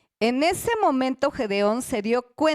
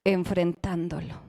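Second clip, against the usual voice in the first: Enfrentándolo drawn out slow and firm; slow emphatic answer; 6 semitones lower than usual. Shown as a demonstration. Preacher answering his own question, slowly. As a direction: stretch Enfrentándolo out. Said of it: "¿Alguien sabe cómo va a vencer tu miedo?".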